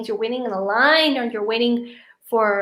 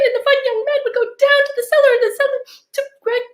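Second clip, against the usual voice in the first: high, fast hysterical wail building louder; panicked sobbing; 11 semitones higher, 7 dB louder, faster than usual. Acted. Daughter telling mother her fear, fast high sobbing. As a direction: gush it all out in a sobbing rush.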